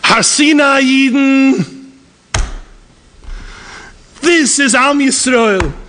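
high, drawn-out preacher's cry; impassioned preaching. Real, not acted. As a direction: proclaim it slowly, pitched high above normal speech.

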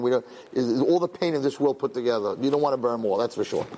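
ordinary talk

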